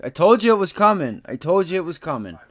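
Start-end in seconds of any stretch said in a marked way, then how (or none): 0.0-1.2 louder and higher, triumphant; gloating shout. Real, not acted. Trader telling viewers he called it, triumphant shout. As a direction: crow that you called it.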